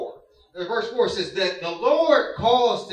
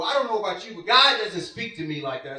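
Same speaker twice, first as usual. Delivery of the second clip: usual speaking voice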